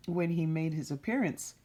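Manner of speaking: plain reading voice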